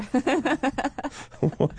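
short high-pitched laugh; high giggle. Real, not acted. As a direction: giggle in disbelief. Reaction laugh.

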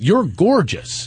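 drawn-out, gushing mock compliment; slow mock compliment. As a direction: stretch it out like a fake flatterer. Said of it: "then he responds with".

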